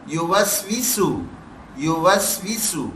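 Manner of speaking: slow یوسوسو with a hissing s; whistling seen. Shown as a demonstration. Teacher making students hear the whistling seen. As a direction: draw out یوسوسو so each س whistles. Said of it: "سین کی آواز سیٹی بھی دے رہی ہے".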